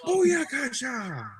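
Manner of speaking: shouted catchphrase. Real, not acted. Player cheering the soul-trapped demon, shouted. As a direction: bellow it in triumph.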